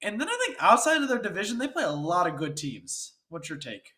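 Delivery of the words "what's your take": usual speaking voice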